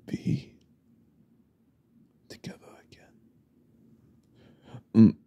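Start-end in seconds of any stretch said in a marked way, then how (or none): 0.1-3.1 unvoiced whisper dying to almost nothing; fading whisper. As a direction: let the words vanish into breath. Creepy stranger trailing off.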